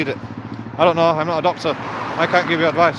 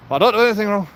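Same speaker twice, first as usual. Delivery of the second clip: higher and louder, punching don't; emphatic insistence; 7 semitones higher, 6 dB louder, much faster than usual. Real, not acted. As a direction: protest your innocence. Insisting he does nothing wrong.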